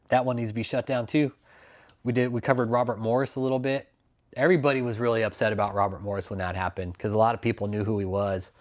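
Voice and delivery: ordinary talk, nothing marked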